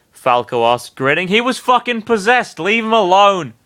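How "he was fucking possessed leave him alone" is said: much higher, firm plea; protective voice. Acted. A fighter shielding Sonic, firm. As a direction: tell them to back off.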